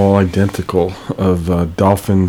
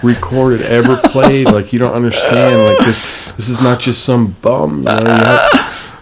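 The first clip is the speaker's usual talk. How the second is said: louder, higher, pleading with wonder; excited insisting; 4 semitones higher, 3 dB louder than usual. Retold or imitated. Dreamer urging grumpy train riders, excited and insistent. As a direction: make them see how great he is.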